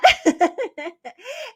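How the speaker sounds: a high burst of laughter. Real, not acted. Laughing while telling viewers about her uncle. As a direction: laugh out at the memory.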